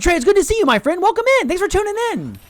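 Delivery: very high-pitched, gushing greeting; excited welcome. Real, not acted. Streamer welcoming a friend into the stream, excited. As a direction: squeal the welcome high and eager.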